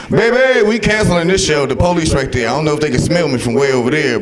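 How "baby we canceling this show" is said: mock panic. Panicked retold reaction.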